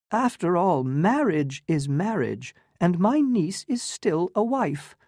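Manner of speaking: put-on high, affected elderly lady; fluttery old aunt. Medora tells Newland about the Count, high and affected. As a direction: play a gushing society aunt.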